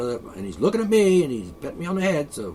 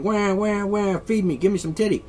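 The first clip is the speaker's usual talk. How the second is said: higher mock wailing newborn; mock baby cry; 6 semitones higher than usual. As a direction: wail like a hungry baby, half in jest. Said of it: "it's going".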